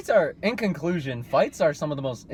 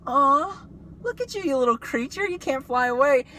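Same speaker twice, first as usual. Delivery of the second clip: put-on much higher, babying; cooing to a bird; 8 semitones higher than usual. A kid cooing at the hurt pigeon he saved, a mock tender coo. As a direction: coo at the little creature.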